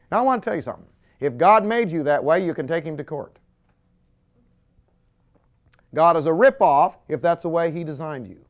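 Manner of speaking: full-force preacher; preaching emphatically. Acted. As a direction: hammer the point home. Preacher declares boldly.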